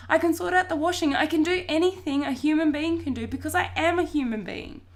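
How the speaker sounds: high, excited boasting; frantic insistence. A narrator's reading. Boy sorting washing to prove he's human, frantic insistence. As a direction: build a breathless case, pitched high.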